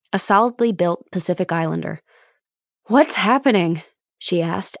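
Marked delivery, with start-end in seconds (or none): 2.9-3.8 breathless after sprinting across the compound; out of breath. Acted. Doctor arriving at the scene, mildly breathless. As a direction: gasp the question between breaths. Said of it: "out of breath".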